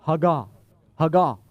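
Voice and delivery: Hagah, ha-GAH, the second syllable loud and falling; saying hagah. Shown as a demonstration. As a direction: sound out Hagah for the congregation to repeat. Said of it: "Everybody say that".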